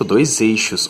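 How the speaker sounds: usual speaking voice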